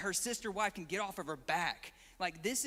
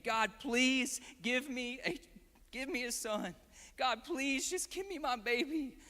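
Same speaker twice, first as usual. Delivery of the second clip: pleading prayer; 5 semitones higher than usual. Retold or imitated. Hannah begging God for a son, pleading. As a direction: beg God desperately in Hannah's place. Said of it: "she's, she's pleading".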